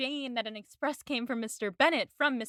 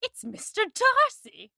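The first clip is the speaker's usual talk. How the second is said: much higher, slow, drawn-out reveal; dramatic reveal; 12 semitones higher, 6 dB louder, slower than usual. Retold or imitated. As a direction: land the name like a cliffhanger. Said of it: "And she goes".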